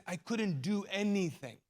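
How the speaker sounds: usual speaking voice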